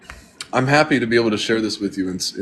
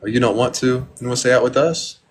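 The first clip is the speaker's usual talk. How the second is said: ordinary delivery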